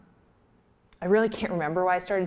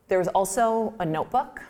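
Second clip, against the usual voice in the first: ordinary talk, nothing marked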